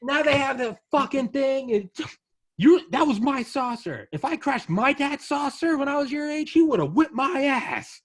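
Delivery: high, loud, put-on furious father; angry alien dad. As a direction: rant at your kids like an old-school dad. Alien dad rants at kids.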